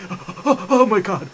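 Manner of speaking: unvoiced panicky gasps into words; shaking with fear. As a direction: imitate gasping in terror on the roof. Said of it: "I'm shaking like crazy".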